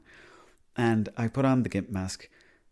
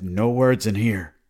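loud, slow retold command; stern order; 7 dB louder, slower than usual. Man tells the friend who starts talking, stern order. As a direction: lay down the rule, loud and drawn out.

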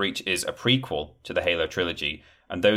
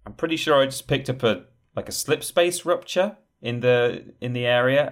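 quick, higher radio chatter; radio operator; 7 semitones higher than usual. Retold or imitated. Soldier reporting a slip space rupture over comms, alarmed. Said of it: "And someone saying".

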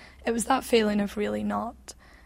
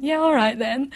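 mock-posh English kid voice, a bit higher and louder; playful exaggerated English accent; silly English accent; 4 semitones higher, 8 dB louder than usual. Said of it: "my silly English accent and going".

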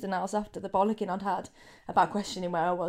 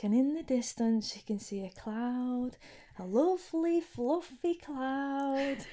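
soothing meditation voice; 3 semitones higher, 7 dB quieter, slower than usual. Retold or imitated. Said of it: "she's like".

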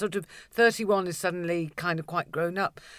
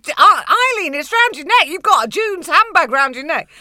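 laughing exclamation; 12 semitones higher, 14 dB louder than usual. Retold or imitated. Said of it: "I said".

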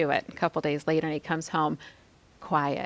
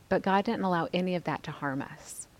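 ordinary talk

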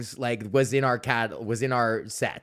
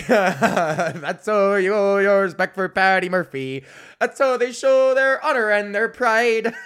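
loud, high, laughing mock proclamation; hammed-up proud declaration; 8 semitones higher, 7 dB louder, slower than usual. Retelling his bar stage moment, a loud laughing mock toast. Belting Paddy Murphy tribute, laughing.

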